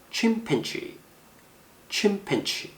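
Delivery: "chimpanzee" high on CHIM, softly falling away; first-syllable stress. Shown as a demonstration. Teacher models the word twice, first-syllable stress. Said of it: "액센트 이름절에 있습니다".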